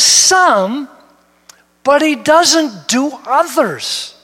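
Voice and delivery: rising to a loud, high preacher's cry, then slow; preaching at full force. Acted. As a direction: build the question like a pulpit climax, dragging the last words.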